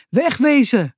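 storybook man shouting, only moderately loud; called out. A narrator's reading. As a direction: call it out sharply like an angry man. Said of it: "riep een man".

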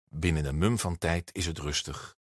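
ordinary delivery, nothing marked

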